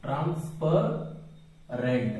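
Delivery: Transparent stretched out very slowly; slow dictation. Shown as a demonstration. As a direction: dictate transparent for students to copy. Teacher dictating English term.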